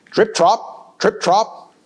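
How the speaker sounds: plain reading voice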